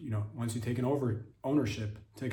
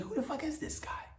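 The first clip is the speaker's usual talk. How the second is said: amazed wondering; 5 dB quieter than usual. Retold or imitated. Wild wondering about Banksy.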